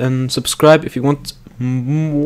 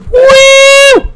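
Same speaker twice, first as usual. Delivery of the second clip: high loud cheering yell; excited whoop; 9 dB louder, much slower than usual. Real, not acted. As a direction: whoop like you nailed a trick. Cheering a stunt.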